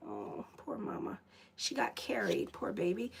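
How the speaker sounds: soft, whispered coo to a pet; hushed, sorry murmur; pitying whisper. Real, not acted. Consoling her cat.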